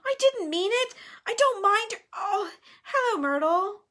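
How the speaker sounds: high, flustered backpedalling; panicked plea turning to an awkward greeting; frantic. A narrator's reading. Hermione caught out as Myrtle arrives, flustered. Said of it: "Hermione whispered frantically".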